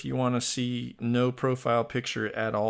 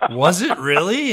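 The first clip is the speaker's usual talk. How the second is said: laughing high and loud through the question; giggling disbelief, loud and high; 8 semitones higher, 8 dB louder than usual. Retold or imitated. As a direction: burst out laughing while you ask it. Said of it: "And I'm like".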